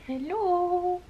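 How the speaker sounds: high squeaky pet voice; dog voice. Pretend. Voicing the dog's greeting.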